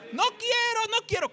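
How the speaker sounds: very high-pitched wailing refusal; hammed-up whine of someone being dragged off; mock protest. Lost one refuses to be taken, mock protest.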